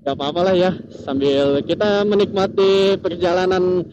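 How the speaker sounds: normal talking voice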